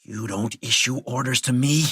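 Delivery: mild sneer, tense and indignant. A narrator's reading. President rebuffing his handler, mildly sneering. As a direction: push back with a touch of contempt. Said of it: "Flannery sneered".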